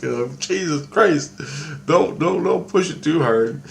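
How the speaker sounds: a loud mock plea to stop pushing; exasperated plea. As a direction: beg him to back off, half laughing. Exasperated plea in retold dream.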